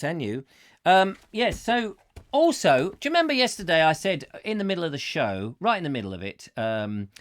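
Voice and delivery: ordinary talk, nothing marked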